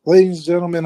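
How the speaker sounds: normal talking voice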